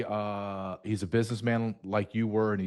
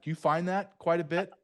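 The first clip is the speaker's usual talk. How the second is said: usual speaking voice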